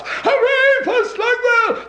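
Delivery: high, drawn-out slow shout from the crowd; crowd cheer. Acted. Crowd cheering the governor's car, high slow shout.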